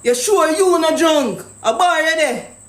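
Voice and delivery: ordinary delivery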